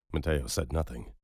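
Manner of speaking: plain reading voice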